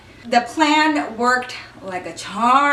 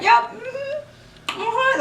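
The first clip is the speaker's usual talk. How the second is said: sly singsong; 12 semitones higher, much slower than usual. Retold or imitated. Smug reply while Jake watches.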